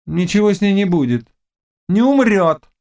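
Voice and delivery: high, insistent witch voice; dismissive witch. Acted. Witch assuring the Cossack she will live, high and dismissive. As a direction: brush the fear away in a high pitch.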